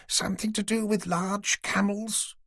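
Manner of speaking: put-on an old lady patiently humouring the police; elderly lady.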